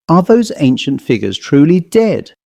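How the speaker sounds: usual speaking voice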